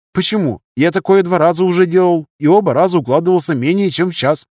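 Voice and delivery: high-pitched junior doctor objecting; defensive young surgeon. Acted. (молодой хирург, высоким голосом, возражая)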